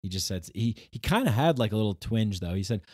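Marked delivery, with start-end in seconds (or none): none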